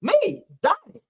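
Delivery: high, slow put-on voice; hammed-up self-introduction; mock Donnie voice. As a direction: announce yourself grandly. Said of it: "he said that I".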